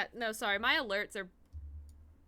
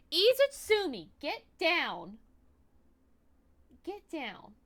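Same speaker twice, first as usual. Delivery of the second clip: 1 scolding the cat; 6 semitones higher, 4 dB louder, slower than usual. Real, not acted.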